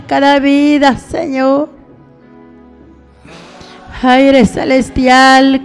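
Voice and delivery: ordinary delivery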